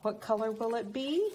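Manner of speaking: ordinary delivery, nothing marked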